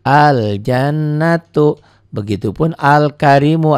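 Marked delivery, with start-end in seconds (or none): 0.0-1.8 Al-jannatu with the l sounded, slowly; careful recitation. Shown as a demonstration. Teacher modelling the word for pupils, slow. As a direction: chant Al-jannatu, lam clear. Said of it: "lamnya dibaca".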